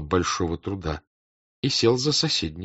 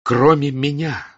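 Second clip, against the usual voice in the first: agitated; 5 semitones higher, 6 dB louder than usual. Acted. Rostov agitated confrontation.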